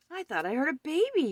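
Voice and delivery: high, loud, fast outburst; alarmed cry. A narrator's reading. Man rushes downstairs to the night keeper, alarmed cry. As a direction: burst in crying out. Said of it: "he cried".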